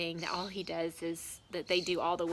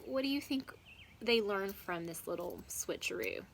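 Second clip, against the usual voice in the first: plain reading voice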